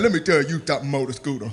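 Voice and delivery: lightly voiced low, soft mumble; low mumbled Fat Albert. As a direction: drop the voice low and quiet. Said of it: "He said".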